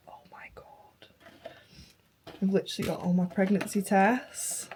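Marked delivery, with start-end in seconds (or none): 0.1-1.1 overcome, barely audible whisper; real sad whisper. Real, not acted. Mother moved by pregnancy keepsakes, sad whisper. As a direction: let it escape as a tiny, upset whisper. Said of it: "makes me so sad".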